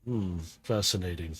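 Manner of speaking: low, soft, detached musing; Shockwave. Acted. Scientist watching the fight from above, low intrigued murmur.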